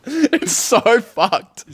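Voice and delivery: slow, high, loud wheeze of laughter; laughing. Real, not acted. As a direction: squeeze the words out through laughter. Cracking up after own impression.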